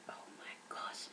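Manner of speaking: hushed, acted-out "oh no" whisper; barely voiced, retold shock; whispered dismay. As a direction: whisper it as a private moment of dread. Retold private dread, whispered.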